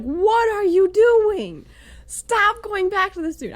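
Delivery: high, loud, drawn-out exasperation; frustrated scolding. Acted. Woman scolding her girlfriends over a man, loud high frustration. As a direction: plead loudly, stretching each word in disbelief. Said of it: "I was still so frustrated".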